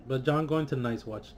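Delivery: usual speaking voice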